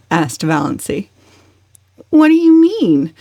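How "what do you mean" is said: higher, louder bewildered aunt. A narrator's reading. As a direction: blurt it out in confusion. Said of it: "said bewildered Aunt Alberta".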